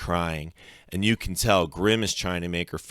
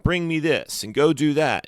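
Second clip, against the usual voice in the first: higher-pitched bossy orders; 8 semitones higher, 4 dB louder than usual. A narrator's reading. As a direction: order someone around. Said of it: "ordering me around".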